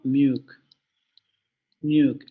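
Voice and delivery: "milk" said as "miuk", the L turned into a U; vocalized L. Shown as a demonstration. Teacher demonstrating the Australian vocalized L. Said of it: "Esto se va a pronunciar como".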